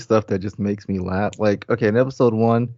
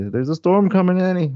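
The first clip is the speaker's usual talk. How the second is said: put-on high, raised old-lady warning; imitating the old lady; 8 semitones higher, 4 dB louder than usual. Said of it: "that lady. Yeah, the old lady".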